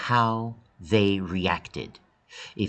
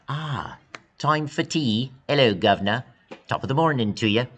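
a hammed-up British accent, a little higher; mock British accent; 5 semitones higher, 3 dB louder than usual. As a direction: play a silly Brit greeting the class in a British accent. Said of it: "speak with a British accent".

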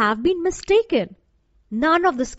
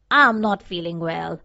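low-pitched scarecrow voice, lightly smiling; scarecrow with a smile; 6 semitones lower than usual. A narrator's reading. Scarecrow answering the girl, low with a smile. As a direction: drop low, keep a slight smile. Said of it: "said the scarecrow with a smile".

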